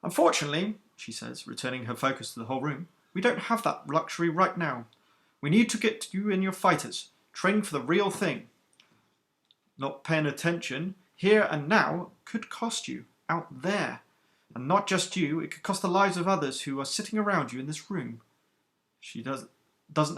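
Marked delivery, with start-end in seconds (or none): 0.0-0.8 stern captain, higher and louder than the narration; iron-voiced captain. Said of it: "the iron command in her voice". 3.1-18.2 stern captain, higher and louder than the narration; iron-voiced captain. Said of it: "the iron command in her voice".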